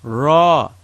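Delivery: "Ra" with a tapped Arabic r; teacher modeling "Ra"; rolled ra. Shown as a demonstration. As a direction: tap the r in "Ra". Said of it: "Ra, fatha, ra".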